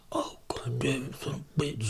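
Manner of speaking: bored muttering. Acted. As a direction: let it dribble out very softly, unimpressed. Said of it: "He looks bored when he hits him".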